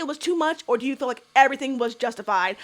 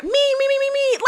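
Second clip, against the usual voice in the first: mocking pick-me; 12 semitones higher than usual.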